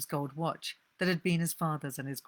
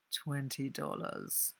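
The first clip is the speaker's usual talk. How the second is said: flat quiet offer; 2 semitones lower, 7 dB quieter, slower than usual. Acted. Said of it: "not quite sure what accent she's meant to have".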